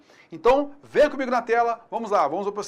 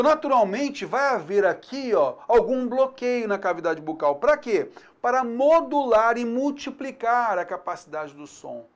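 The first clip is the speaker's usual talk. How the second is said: normal talking voice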